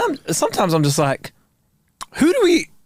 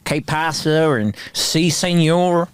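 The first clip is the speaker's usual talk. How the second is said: mock American accent; 3 semitones higher than usual. Playing a local griping about Walmart, mock American accent. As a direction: grumble in a put-on American accent.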